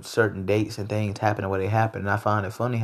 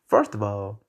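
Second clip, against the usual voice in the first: ordinary talk, nothing marked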